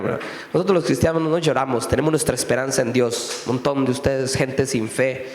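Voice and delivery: hint of sanctimonious church voice, mocking; preacher mocking believers who say Christians don't cry. Mocking self-righteous believers.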